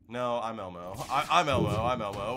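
loud rising insistence; excited claim. Pretend. Streamer claiming he is Elmo, loud and gleeful.

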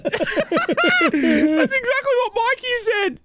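talking through laughter; high, helpless laughing delivery. Real, not acted. Cracking up at friend's answer.

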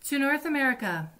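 a light, playful mock yell; mild storybook yell. A narrator's reading. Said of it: "I yelled to Nanny".

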